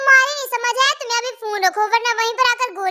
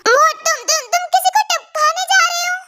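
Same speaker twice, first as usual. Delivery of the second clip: shocked; 7 semitones higher than usual. Acted. Girl shocked he will kill.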